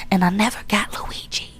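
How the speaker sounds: hushed secretive whisper; conspiratorial whisper. Real, not acted. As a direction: drop to a whisper as if sharing a rumor. Whispered aside about Luigi.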